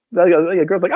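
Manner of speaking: ordinary talk, nothing marked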